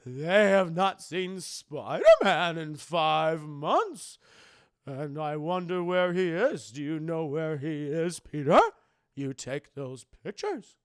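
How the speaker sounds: put-on slow, loud fussing old aunt; Aunt May voice. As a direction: put on a doting old aunt's voice. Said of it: "I gotta do the Aunt May voice".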